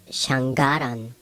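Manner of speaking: shangaran with NG plus a hard G; wrong hard G. Shown as a demonstration. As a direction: split shangaran as shang-garan. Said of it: "it's also not pronounced".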